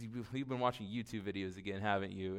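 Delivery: a wife catching him out, dryly; imitating wife. Wife teasing him, retold.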